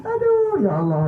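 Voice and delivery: high hammed-up crying wail; mock wailing. Wife hears the husband's prayer, hammed-up high wailing.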